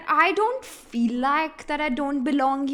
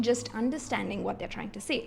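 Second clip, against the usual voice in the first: ordinary talk, nothing marked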